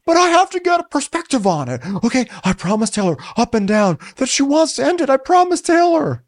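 high, pleading mock whine; mocking Shep. Shep insisting to the women, high pleading mock whine.

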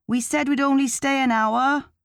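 bored friend speaking up over loud music; speaking loudly. A narrator's reading. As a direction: call it into her ear over the disco. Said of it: "Lenny says loudly into my right ear".

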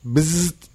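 buzzing zap noise; short electric bzzzt; bug zapper zap. Pretend. Ad read buzzer sound.

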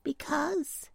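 hesitant little storybook voice, a touch sad; sad little pumpkin. A narrator's reading. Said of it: "answered the little pumpkin sadly".

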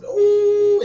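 a high, loud crying oh. Real, not acted. Preacher at congregation's silence, loud high crying oh. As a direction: wail the oh out at full voice.

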